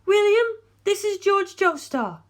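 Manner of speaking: put-on high, pleading young woman's voice; lifted female voice, anxious and imploring. A narrator's reading. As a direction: voice a distraught heiress begging for help. Darlington introduces.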